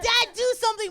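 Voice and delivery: high, loud plea for help; mock urgent appeal. Comedian urging dad to stop toddler, mock-urgent shout. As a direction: call on a parent to step in.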